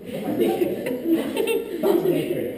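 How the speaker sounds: high, laughing self-mockery; laughing at himself. Real, not acted. Speaker joking about his eighth-grade thoughts, laughing at himself. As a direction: laugh through it at your own silliness.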